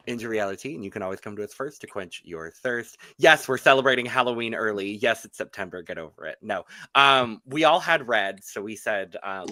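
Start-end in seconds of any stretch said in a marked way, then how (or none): none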